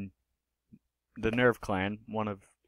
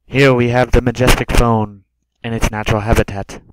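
booming wildlife narrator, much louder; nature documentary; 14 dB louder than usual. Pretend. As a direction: present the phone like rare wildlife. Mock documentary about the phone.

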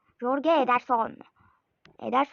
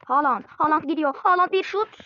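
louder, higher name calls; excited build-up; 4 semitones higher, 7 dB louder than usual. Real, not acted. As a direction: build to the shot. Chance on goal building.

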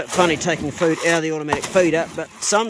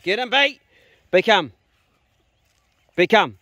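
loud, sharp dog call; 5 semitones higher, 7 dB louder than usual. Real, not acted. Farmer yelling his dog's name to recall him, loud call.

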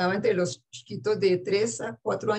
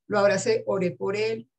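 normal talking voice

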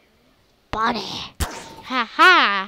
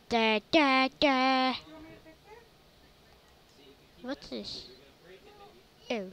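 ordinary talk, nothing marked

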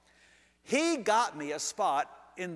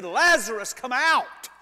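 loud, raised-pitch summons; commanding call; 3 semitones higher, 7 dB louder than usual. Acted. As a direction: call him out with full authority. Jesus calling the dead man out.

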